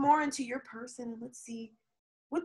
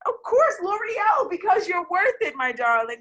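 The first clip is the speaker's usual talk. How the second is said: lightly mock ad voice; 7 semitones higher, 6 dB louder than usual. Reassuring the viewer with a mock L'Oreal ad line. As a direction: toss off the slogan like a beauty ad.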